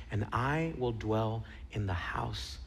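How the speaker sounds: slow psalm, fading to a whisper; hushed closing verse with a long pause before the last word; soft voice. Retold or imitated. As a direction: recite slowly, sinking almost to nothing. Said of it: "in a soft voice".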